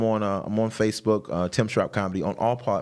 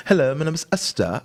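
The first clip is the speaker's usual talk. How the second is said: imitating his wife's British accent, pitched high; high mock British woman's introduction; 8 semitones higher than usual. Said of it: "her accent, man, she says".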